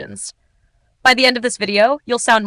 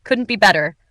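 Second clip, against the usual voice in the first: usual speaking voice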